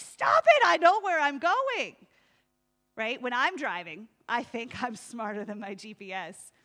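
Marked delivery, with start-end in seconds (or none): 0.0-2.0 exasperated yell. Retold or imitated. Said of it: "you're like".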